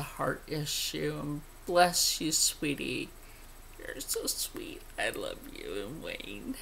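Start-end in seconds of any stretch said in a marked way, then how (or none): 3.8-6.6 cooing. Real, not acted. Thanking a sweet viewer, soft cooing. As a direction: coo it tenderly to a fan.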